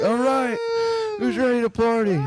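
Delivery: high shouted mock cheer; sarcastic party host. Sarcastically retelling the party restart after the accident, high mock shout.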